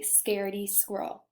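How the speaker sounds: ordinary delivery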